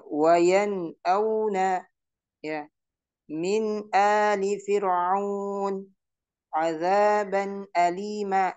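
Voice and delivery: Wayan auna, Min ali fir'aun slow with clear nun; recited with izhar. Shown as a demonstration. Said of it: "dibaca izhar". (bacaan izhar perlahan)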